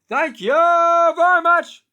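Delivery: high, loud and slow; over-the-top comic sign-off. Acted. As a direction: belt it like a lounge comic. Catchphrase, stand-up parody.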